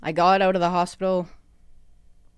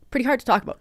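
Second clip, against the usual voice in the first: getting emotional; 6 semitones higher, much faster than usual. Real, not acted. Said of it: "sorry, I'm getting a little emotional".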